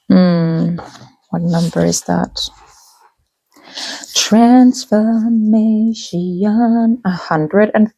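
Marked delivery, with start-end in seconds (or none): 1.3-2.5 soft whisper to herself. Real, not acted. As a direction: whisper the question under breath. Muttering to herself searching cards.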